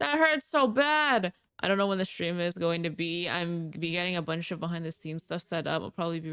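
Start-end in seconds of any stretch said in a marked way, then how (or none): 0.0-1.3 mock hurt whine. Hit in game.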